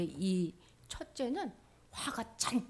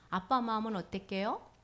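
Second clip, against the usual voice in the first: normal talking voice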